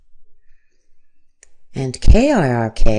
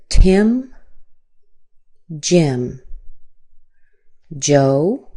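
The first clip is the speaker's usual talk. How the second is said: usual speaking voice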